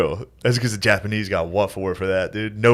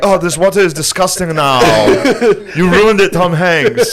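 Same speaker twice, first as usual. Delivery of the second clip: mock Nazi complaint; 6 semitones higher, 5 dB louder than usual. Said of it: "the Nazis being like".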